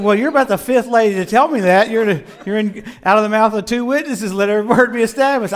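worked-up retelling of a retort; exasperated comeback. Retold or imitated. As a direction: fire it back in comic exasperation. Retold comeback to critical lady.

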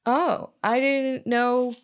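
high, slow, drawn-out innocence; mock naive realisation. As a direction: draw it out like a sheepish discovery. Acts own naive past reaction.

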